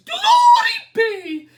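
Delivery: put-on very high, loud wailing old lady; crying old lady. A narrator's reading. Old lady wails to the wise man, high and loud. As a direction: wail it like a frantic little old lady. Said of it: "The little old lady cried".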